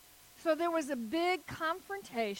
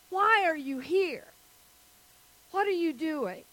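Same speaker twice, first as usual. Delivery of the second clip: higher, louder pointed questioning; retold challenge; 4 semitones higher, 4 dB louder than usual. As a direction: imitate an official challenging a newcomer. Retold challenge by admissions man.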